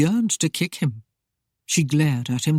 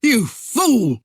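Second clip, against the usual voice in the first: angry bellow, pitched up; acted outraged roar, higher voice; 6 semitones higher, 4 dB louder than usual. A narrator's reading. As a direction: bellow it at a bungling underling. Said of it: "he bellowed".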